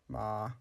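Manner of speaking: MA held level at mid pitch, soft; mid sustained tone. Shown as a demonstration. Teacher offers an easier third tone, "ma" mid and level. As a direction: keep "ma" steady in the middle of your voice. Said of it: "use a mid sustained tone".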